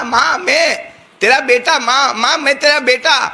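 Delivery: a son begging to be let in; دروازے پر ماں کو پکارتا بیٹا; son calling mother. Retold or imitated. Son calls at door.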